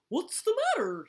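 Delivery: high, loud alarmed question; crying out. A narrator's reading. Hippo jogs over to help, crying out. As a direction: call out in alarm. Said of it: "he cried".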